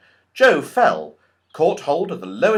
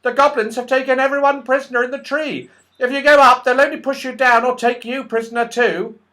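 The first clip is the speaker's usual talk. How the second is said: much higher, quick little squirrel voice; tiny squirrel voice; 12 semitones higher, 8 dB louder, faster than usual. Acted. The red squirrel warns Joe the goblins took everyone, tiny and quick. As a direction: chatter like a tiny worried squirrel. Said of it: "said a tiny voice".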